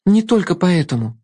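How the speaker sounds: put-on girl objecting. A narrator's reading. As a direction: protest as a young girl. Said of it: "возразила девочка".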